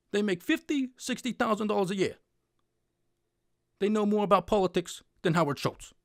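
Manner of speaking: lightly voiced, stammering outraged politician; Bernie Sanders impression. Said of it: "Well, he says".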